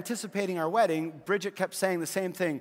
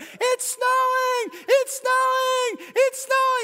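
high, very loud, drawn-out shriek of joy; excited scream; 10 dB louder, much slower than usual. Retold or imitated. Wife calling at dawn, screaming with delight. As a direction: shriek it like she can't believe it. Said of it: "She was screaming".

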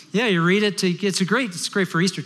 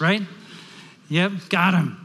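ordinary talk

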